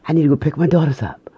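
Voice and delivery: gasp, then quick, high-pitched alarm; gasping, hurried retold panic; acted sudden realization. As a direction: re-enact remembering with a start. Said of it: "then I realized that".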